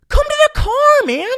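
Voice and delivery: very high, loud plea across a yard; desperate calling out. Retold or imitated. Trapped driver calls the farmer over, desperate shout. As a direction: yell it out, pleading.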